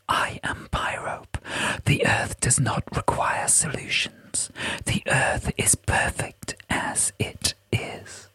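slow, breath-only whisper of an ancient being; whispering spirit. Acted. Pyrope declares the Earth perfect to Jessica, whispered slowly.

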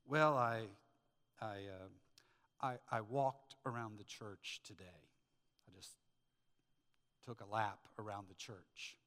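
low, halting, reluctant answer; embarrassed report trailing into quiet; sheepish husband. Acted. Imagines himself answering his wife.

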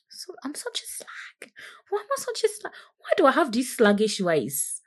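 high, hammed-up whimper of self-pity; fake-cry the words, whiny and high; mock wailing. Mock self-pity over slag remark.

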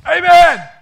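loud, high call of amen; a sudden preacher's amen; amen shout. Real, not acted. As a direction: shout it out to the congregation. Preacher calls out for response.

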